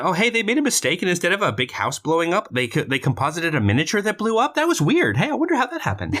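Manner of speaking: high, lilting mock surprise; mock puzzled. Host reading out a sarcastic note, mock puzzled. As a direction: feign innocent wonder in a high, lively voice.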